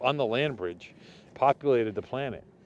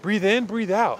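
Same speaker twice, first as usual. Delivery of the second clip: hammed-up breathing coach; mock meditation guide; 5 semitones higher, 6 dB louder, slower than usual. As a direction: lead the breath like a yoga teacher.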